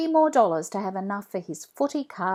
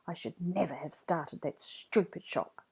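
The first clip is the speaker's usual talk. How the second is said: 1 inner thought; 2 semitones lower, 9 dB quieter than usual. A narrator's reading. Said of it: "he thinks".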